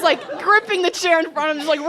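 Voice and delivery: ordinary delivery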